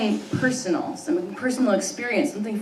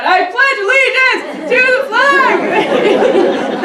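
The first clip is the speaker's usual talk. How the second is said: much higher, louder, slow shouted pledge; 11 dB louder, much slower than usual. Retold or imitated. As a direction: belt it out like the proudest kid in class. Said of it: "He said the pledge so loud".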